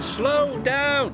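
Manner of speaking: high, loud warning call. A narrator's reading. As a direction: shout at them to slow down. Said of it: "Kelly called".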